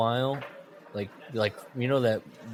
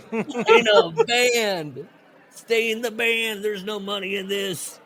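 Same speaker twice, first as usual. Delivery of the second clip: Skinner voice; 4 semitones higher than usual. Retold or imitated. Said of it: "I switched into a Skinner thing".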